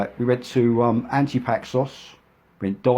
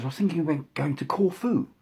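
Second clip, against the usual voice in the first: imitating a posh woman, higher voice; posh lady; 7 semitones higher than usual. Shira inviting Mick on holiday, posh imitation. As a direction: put on a plummy posh lady. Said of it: "she was quite posh".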